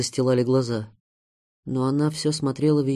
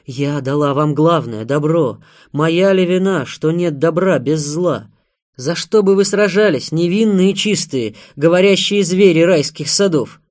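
loud, heated tirade, building higher; raised voice, hurling the questions; impassioned shouting; 4 semitones higher, 8 dB louder than usual. A narrator's reading. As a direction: cry it out, throwing caution away. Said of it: "Она кричала, забыв об осторожности".

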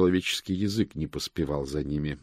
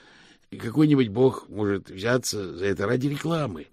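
higher-pitched pleading burst; desperate exclamation; 4 semitones higher, 3 dB louder than usual. Acted. Hubert clinging to hope of divine gold, desperate. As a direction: plead it out in despair. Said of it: "воскликнул Хьюберт в отчаянии".

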